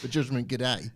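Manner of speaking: a hint of Australian accent; Australian-flavoured pun; mock Australian. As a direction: lightly put on Aussie. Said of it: "in an Australian accent".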